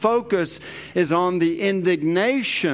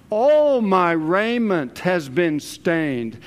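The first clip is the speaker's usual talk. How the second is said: slow, emphatic ALL at the start; stress on ALL; slower than usual. Acted. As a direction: punch a long ALL, slowly. Preacher repeats the stressed phrase.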